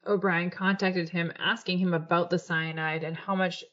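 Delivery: normal talking voice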